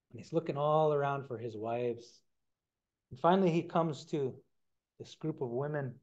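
ordinary delivery

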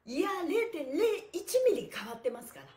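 teasing emphatic claim; playful insistence. Real, not acted. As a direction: insist jokingly it changed. Joking insistence.

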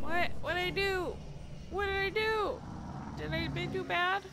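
high, loud, baffled questioning; bewildered outcry. Real, not acted. Gamer asking what they did wrong, bewildered outcry. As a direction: cry out in loud, high-pitched confusion.

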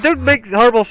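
high loud frightened cry; scared yell. Real, not acted. Gamer yells at the mob's noises, scared.

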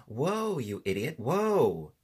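high, loud, drawn-out shouts; angry shout. A narrator's reading. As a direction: holler at a runaway mount, exasperated. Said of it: "shouted Tip".

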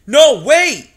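loud high shout; crying out. A narrator's reading. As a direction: yell to stop the crowd. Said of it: "he cried out to the mice".

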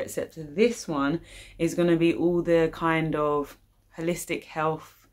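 usual speaking voice

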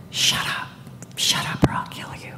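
whispered through clenched teeth; a menacing whisper, teeth clenched. Retold or imitated. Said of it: "telling me in clenched teeth".

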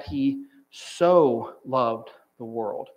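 slow line with "so" held long; weighty, drawn-out SO; stress on SO. Shown as a demonstration. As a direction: set "so" apart and linger on it. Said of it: "this word jumped out".

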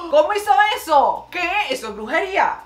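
loud, high-pitched astonished outburst; shocked disbelief. Real, not acted. Vlogger reacting to a baffling stomach trick, shocked. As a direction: exclaim in amazed disbelief.

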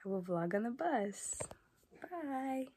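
soft unvoiced whisper. Shown as a demonstration. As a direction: breathe it as a whisper. Repeats the line whispered.

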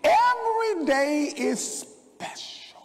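high, loud, slow preacher's cry; drawn-out exultant proclamation; preaching cry. Real, not acted. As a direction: ring it out from the pulpit. Preaching climax.

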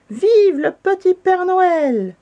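high, loud, drawn-out cheer; long celebratory hurrah; clamour, cheering. A narrator's reading. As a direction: shout it out like a jubilant crowd. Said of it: "suivi d'une clameur".

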